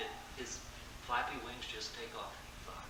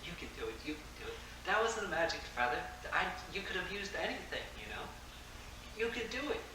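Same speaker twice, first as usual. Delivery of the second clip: mildly urgent, higher-pitched pleading; mock scream; 7 semitones higher than usual. Mouse urges the falling elephant to fly, mild mock scream. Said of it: "the mouse is screaming".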